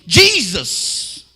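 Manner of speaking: loud startled cry; shout of amazement. Retold or imitated. Atheist crying out as his wife shakes, loud shout. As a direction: call his name out in shock.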